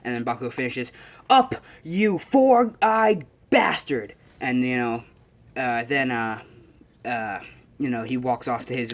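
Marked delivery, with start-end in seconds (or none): 1.3-4.1 slow, high, loud acted yell; enraged high-pitched bellow; angry shout. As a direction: hurl the insult, drawing out each word. Retold character finishes angry insult.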